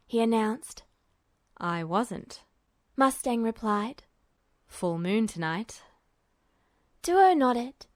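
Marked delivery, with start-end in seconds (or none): 1.6-2.4 lower, softer, slow man's voice; cool, unreadable older officer; a calm, low voice from the end of the table; Mustang's voice. A narrator's reading. 4.7-5.8 put-on cool, unreadable older officer; a calm, low voice from the end of the table; Mustang's voice. A narrator's reading.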